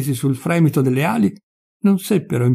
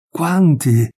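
narrated tearful pity, rising higher on the repeat; pitying exclamation; 4 semitones higher, much slower than usual. A narrator's reading. Nazzaro sees all the caged birds, pitying exclamation. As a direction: exclaim with mild, tender pity. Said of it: "esclamò Nazzaro pietosamente, con le lacrime agli occhi".

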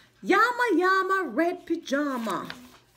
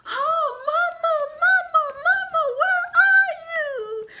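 much higher, louder mock wail; hollering baby; 14 semitones higher, 6 dB louder than usual. Baby llama shouting for mama in the dark. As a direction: howl for mama like a toddler, laughing through it. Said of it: "hollers loudly for his mama".

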